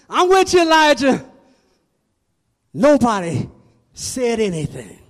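ringing, insistent sermon delivery; full-force preaching. Acted. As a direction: preach it hard, stretching the key phrases.

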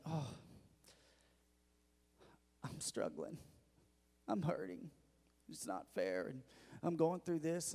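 mope quietly, as if hurting; acted complaining to friends. Churchgoer moaning to friends on the phone, mock downcast and soft. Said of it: "we'll call our friends and say".

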